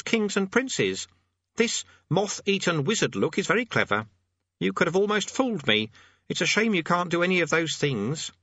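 high-pitched eager character voice; fussy amateur demonologist. Acted. Voices Thursley assessing his demon.

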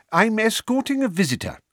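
Mr Weasley voice. A narrator's reading. Mr Weasley to the guard.

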